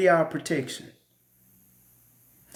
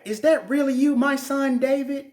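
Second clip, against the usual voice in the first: sugar-coat it, you don't mean it; fake sweet talk; 8 semitones higher than usual. Saul calling out to David, high fake sweetness. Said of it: "The same dude who trying to unalive me".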